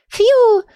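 high whistled "phew"; whistle. A narrator's reading. Little book reacts to her doubt, whistling. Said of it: "присвистнула малышка".

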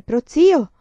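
loud, high call. A narrator's reading. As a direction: call out urgently to an elder. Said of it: "sentì Giada Lucente chiamare". (richiamo a voce alta)

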